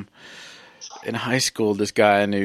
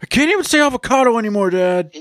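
high, fast wail of complaint; mock anguished outburst; 11 semitones higher, 3 dB louder than usual. Playing the son confronting his dad, mock anguish. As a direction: blurt it out like a hammed-up wronged son.

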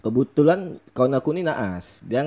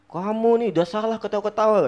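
higher-pitched imitation of a telling-off; scolding teacher; 8 semitones higher than usual. Imitating the teacher scolding a laughing pupil, reproachful.

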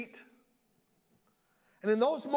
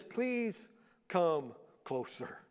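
slow, soft and tender; soft, slow, tender invitation to his brothers; gentle invitation; 4 semitones lower, 6 dB quieter, much slower than usual. Acted. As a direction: draw them in kindly, very slowly.

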